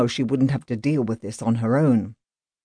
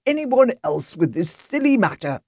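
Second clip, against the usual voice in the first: lightly voiced, irritated householder; indignant rich master of the house; haughty gentleman; 9 semitones higher than usual. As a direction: brush off the policeman with disdain. Grant refusing the constable.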